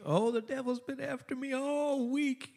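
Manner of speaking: high, soft mock Southern lady voice; a hammed-up Southern church lady, pitched high; southern drawl. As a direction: imitate a super-spiritual Southern church lady. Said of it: "little bit of southern drawl".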